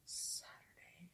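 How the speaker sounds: breathy slow whisper. Pretend. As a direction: whisper the word like a secret. Echoes the word in a whisper.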